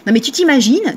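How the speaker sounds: ordinary talk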